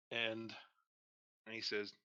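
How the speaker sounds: ordinary delivery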